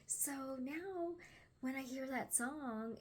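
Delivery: ordinary talk, nothing marked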